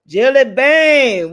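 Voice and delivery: loud and slow, stretching the names; calling out names. Real, not acted. Streamer hailing viewers in chat with a loud drawn-out call. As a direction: sing out the names across the room.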